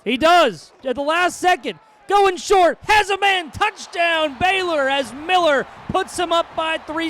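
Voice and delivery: loud, high, building sports shout; excited touchdown shout. Acted. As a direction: yell the touchdown call.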